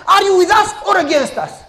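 high loud demand; challenging Joshua. Acted. Joshua demands of the stranger, high and loud. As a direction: confront the stranger sword in hand.

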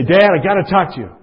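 high, pressing calls of Dad; urgent calling out. Retold or imitated. Son waking his snoring father at night, urgent and loud.

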